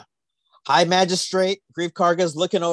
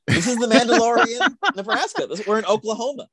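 laughing; 8 semitones higher than usual. Real, not acted. Laughing joke.

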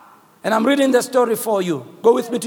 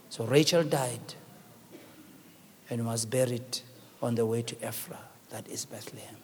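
sinking lower and softer to a near whisper; hushed sorrow; 10 semitones lower, 8 dB quieter than usual. Acted. Preacher reading Rachel's death to the congregation, hushed and sorrowful. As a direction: let the death scene fade to nothing.

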